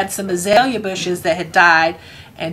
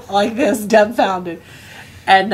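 plain reading voice

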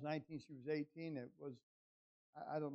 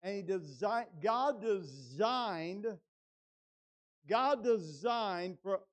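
loud, weighty pulpit declarations; emphatic preaching; 4 semitones higher, 8 dB louder than usual. Real, not acted. Preacher telling congregation God designed and limits adversity, loud and emphatic. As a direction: hammer each point, stretching key words.

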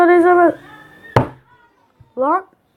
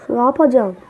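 ordinary talk

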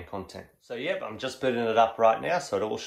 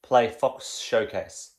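slow command; much slower than usual. Real, not acted.

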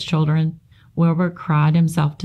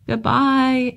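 tiny spider voice; 8 semitones higher than usual. Acted.

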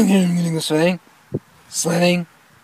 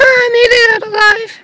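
high relieved cry. Acted. Relief after sledding.

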